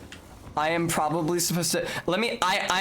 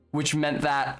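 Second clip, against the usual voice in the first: normal talking voice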